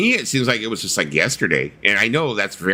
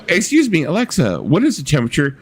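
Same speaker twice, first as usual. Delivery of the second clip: higher polite question; mock homeowner asking; 6 semitones higher, 3 dB louder, faster than usual. Pretend homeowner asking Alexa the temperature. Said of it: "You'd be like".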